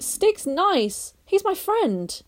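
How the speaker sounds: put-on a boy standing up for his friend; Barney voice. A narrator's reading.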